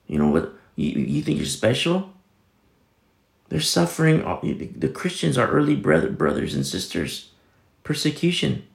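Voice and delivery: normal talking voice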